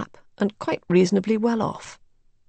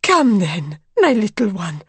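eager cry; 4 dB louder than usual. A narrator's reading. Mother calling her little one, crying out urgently. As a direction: cry out to the child. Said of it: "cried Madame X".

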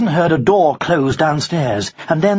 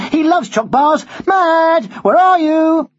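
high, louder schoolboy voice; boy calling pet; 4 dB louder, slower than usual. Acted. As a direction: call out for your parrot in a boy's voice. Boy calls his pet parrot.

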